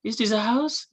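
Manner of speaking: is this a house with a high rising question tune; questioning rise. Shown as a demonstration. Asking a friend to confirm it is a house, rising tune. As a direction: rise sharply at the end like checking. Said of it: "You wanna confirm".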